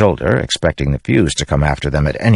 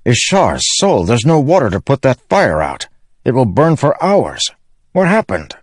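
higher-pitched, dismayed character voice; dismayed onlooker; 8 semitones higher than usual. A narrator's reading. Companion sees the ship burning with no water, dismayed. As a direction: react to the fire in a raised, troubled voice.

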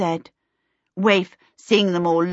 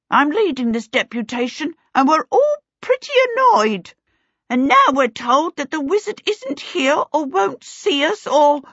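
high kobold voice getting more irate; working into rage; 9 semitones higher, 4 dB louder than usual. A narrator's reading. Kobold leading a deputation to the wizard, working into rage. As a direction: build up indignation as an annoyed little creature. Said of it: "working himself into a rage".

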